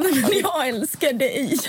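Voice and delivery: slow, high loving wail; mock tearful. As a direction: answer his sobs in kind, hammed up. Retold tearful reply to Josef.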